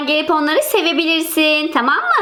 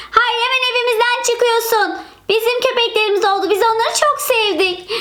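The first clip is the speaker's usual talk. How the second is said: put-on raised childish voice ordering her out; stubborn little girl; 6 semitones higher than usual. Girl orders the former owner out of the house, stubborn childish voice. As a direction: put your foot down like a kid.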